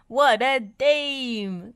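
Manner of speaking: slow, loud croak; hoarse sick voice; croaky. A narrator's reading. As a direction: croak it out with a cold. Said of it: "croaked Bob".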